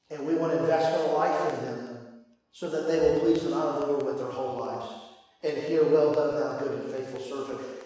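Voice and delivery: soft, low, solemn sermon voice; hushed earnest preaching. Real, not acted. Preacher to parents on God's purpose, hushed and earnest.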